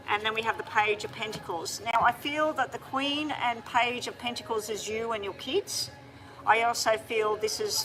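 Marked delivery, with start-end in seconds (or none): none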